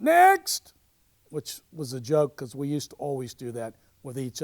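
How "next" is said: sharp, very high, loud shout; yelled out. Retold or imitated. Director dismissing an auditioner, loud high yell. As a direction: bark it across the audition room. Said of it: "the director yells out".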